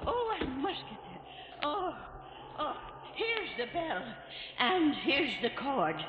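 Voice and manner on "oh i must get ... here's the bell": much softer, breathless, gasping climb; out of breath. Acted. Keeper rushing up lighthouse stairs, out of breath. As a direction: pant your way up the stairs. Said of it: "gets me out of breath".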